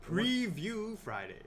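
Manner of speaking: slow, drawn-out host announcement; stretched show intro call; announcer voice. Shown as a demonstration. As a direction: call out the show title slowly like a hype host. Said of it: "transform into my Friday voice".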